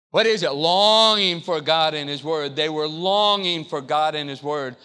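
usual speaking voice

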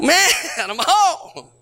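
slow, laughing through the words; laughing relief. Retold or imitated. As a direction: chuckle it out, touched and relieved. Paroled kid reads welcome.

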